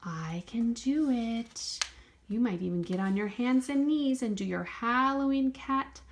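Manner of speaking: ordinary delivery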